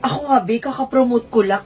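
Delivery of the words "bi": ordinary talk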